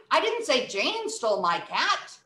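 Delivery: normal talking voice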